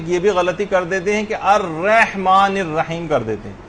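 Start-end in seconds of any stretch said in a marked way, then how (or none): 1.3-3.1 الرحمن الرحیم with a drawn "rey" in place of "rah"; mistaken extra ya. Shown as a demonstration. Teacher imitates many people's error, exaggerated mispronunciation. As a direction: stretch the first vowel of الرحمن الرحیم into a y-glide. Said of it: "اس کے اندر ایک یا آ جاتی".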